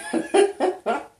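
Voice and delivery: high burst of giggles; laughing. Real, not acted. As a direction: break into a bright giggle. Laughing at her own yawn.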